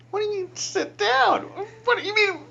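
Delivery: high, loud incredulous squawk; hammed-up yelp of horror; comic panic. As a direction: voice shooting way up in mock panic. Acting out his shock at face-to-face confession.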